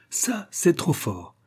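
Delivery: slow, high, drawn-out amazement; marvelling, pitch lifted high; overjoyed disbelief. A narrator's reading. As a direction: stretch it out in delighted disbelief. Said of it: "répétait-il sur tous les tons".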